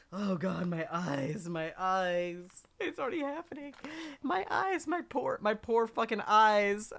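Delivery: high, loud anguished moaning; teary from chopping onions. Real, not acted. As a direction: wail about stinging eyes. Onion fumes burning his eyes.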